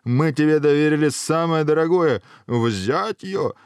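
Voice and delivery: indignant monarch crying out; angry king's shout. Acted. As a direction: order an arrest in outrage. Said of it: "закричал король".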